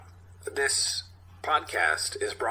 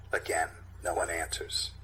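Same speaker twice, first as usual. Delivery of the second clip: ordinary delivery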